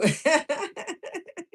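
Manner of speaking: high, happy burst of laughter; delighted laugh. Real, not acted. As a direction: laugh with glee. Delighted at coach arriving.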